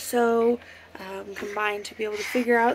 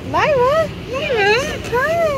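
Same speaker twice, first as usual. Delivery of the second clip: high, loud sing-song call; 7 dB louder than usual. Real, not acted. Calling to baby, high loud.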